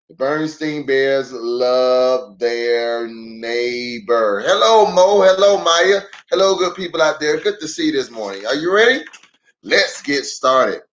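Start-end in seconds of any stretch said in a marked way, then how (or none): none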